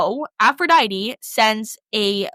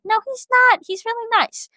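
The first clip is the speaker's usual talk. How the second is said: put-on very high, girlish protest; high-pitched mock indignation; 12 semitones higher than usual. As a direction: defend him in a squeaky-high voice. Defending husband to her sisters.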